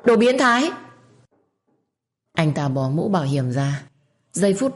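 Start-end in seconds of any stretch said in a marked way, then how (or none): 0.0-0.7 high, loud shriek of pervert; loud yell. A narrator's reading. Cornered girl screams at the stranger, high and loud. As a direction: scream the insult at full force. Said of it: "Tôi tiếp tục gào ầm lên".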